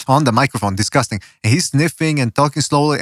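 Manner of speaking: ordinary delivery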